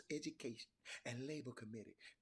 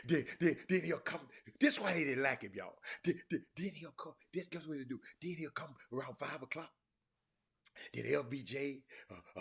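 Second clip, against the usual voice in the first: ordinary talk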